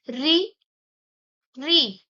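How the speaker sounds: two isolated री, a long pause between; retroflex vowel demonstrated. Shown as a demonstration. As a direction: say री, री with the tongue curled back. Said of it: "आपकी कैसे जीभ ऐसे मुड़ के".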